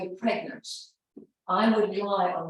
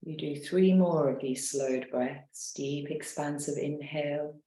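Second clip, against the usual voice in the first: guided meditation; 2 semitones lower than usual. Acted. Leads a guided relaxation.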